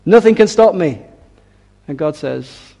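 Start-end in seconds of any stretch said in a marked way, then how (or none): 0.1-1.0 loud, high, swaggering king; puffed-up tyrant bragging loudly; arrogant boast. Acted. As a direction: crow it out as if all-powerful. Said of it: "Sennacherib thinks he's so powerful and so wonderful".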